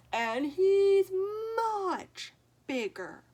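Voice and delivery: mock crying. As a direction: whimper it out to the troll. Goat fearfully pleads.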